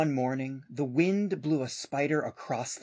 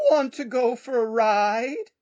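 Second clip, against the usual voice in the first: horse voice; 8 semitones higher, 6 dB louder than usual. Acted. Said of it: "said the horse".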